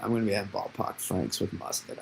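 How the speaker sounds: a hint of Boston, don't overdo it; light Boston accent. Shown as a demonstration. Asked by viewers to say it with a Boston accent. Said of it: "in the Boston accent".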